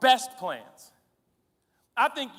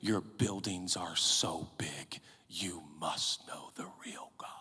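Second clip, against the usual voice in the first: slow, breathless whisper of awe; mock awed whisper; 12 dB quieter, slower than usual. Preacher voicing an impressed world in a whisper. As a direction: hush it like the world is dazzled. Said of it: "And the world will stand back and say".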